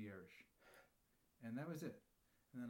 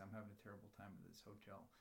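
soft, low and guarded; hushed, low complaint; timid undertone; 5 semitones lower, 10 dB quieter than usual. Acted. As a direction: say it under your breath, afraid of getting fired. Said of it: "I'm so afraid I'm gonna get fired".

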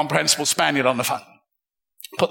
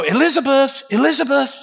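calling voice; 7 semitones higher than usual. Retold or imitated. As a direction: call down the phone like an old gent. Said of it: "I heard this voice go".

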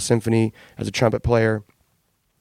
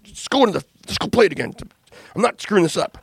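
higher-pitched imitation of a drummer losing his temper; mock tantrum from the studio; retold angry yelling; 8 semitones higher than usual. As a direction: replay his frustrated outburst, pitched up but not truly shouted. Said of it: "He's, like, screaming".

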